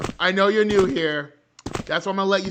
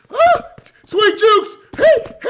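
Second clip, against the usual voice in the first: very high, loud yelps while dodging; excited high-pitched shouting; over-the-top loud; 13 semitones higher, 6 dB louder than usual. Real, not acted. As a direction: squeal it mid-chase in a game. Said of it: "break it down about forty-five notches".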